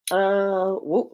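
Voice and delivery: plain reading voice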